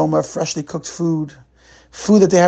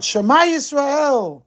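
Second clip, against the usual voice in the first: high, slow ringing cry; 12 semitones higher, slower than usual. A narrator's reading. Schneeweiss facing the revolver cries out his faith, slow high outcry. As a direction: cry the prayer out with your last breath. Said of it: "He cried out".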